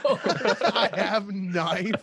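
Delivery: loud, high, drawn-out echo through laughter; laughing mimicry. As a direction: parrot the threat while cracking up. Gleefully echoes the dad impression.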